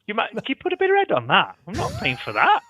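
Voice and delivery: high, pushy mock punter voice; mock indignant customer. Fan demands a cheaper pint in a high mock punter voice.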